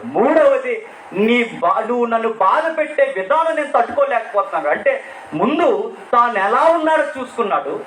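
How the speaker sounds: full-force preaching. Acted. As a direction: preach it hard to the congregation. Preaching climax.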